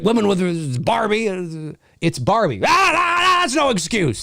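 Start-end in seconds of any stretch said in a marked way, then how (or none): 2.6-4.2 wag a finger with your voice; mock scolding.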